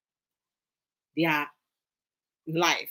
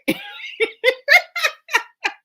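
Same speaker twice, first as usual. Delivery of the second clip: high, loud peals of laughter; big laugh; 5 dB louder than usual. Real, not acted. Host cracking up after it didn't come out right, real laughter. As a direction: crack up helplessly. Cracks up over botched phrase.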